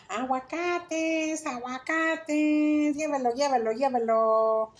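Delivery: high, slow sing-song hawking cry; grito de vendedor, agudo y alargado; shouted vendor call. Retold or imitated. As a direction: belt it out like a boy selling avocados in the street. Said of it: "entraba y gritaba".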